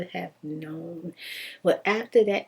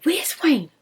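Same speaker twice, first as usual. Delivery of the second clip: demanding wife; 6 semitones higher, 6 dB louder, slower than usual. Retold or imitated. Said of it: "She said".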